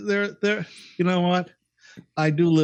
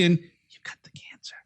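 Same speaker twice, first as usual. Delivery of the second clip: doctor's hushed whisper, softer; whispered bad news; 5 dB quieter than usual. Imitated doctor gives test results to patient, whispering. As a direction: whisper it like a shameful secret. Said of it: "they whisper bad things".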